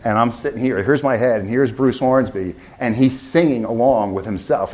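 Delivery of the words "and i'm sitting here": normal talking voice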